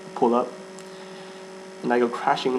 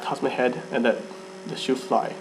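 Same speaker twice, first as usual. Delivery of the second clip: usual speaking voice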